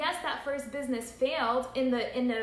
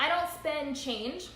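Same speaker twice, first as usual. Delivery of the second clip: normal talking voice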